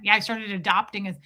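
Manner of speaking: ordinary delivery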